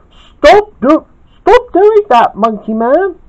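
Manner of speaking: high, loud protest; upset yell. A narrator's reading. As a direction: yell at him to stop. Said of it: "yelled Gawain".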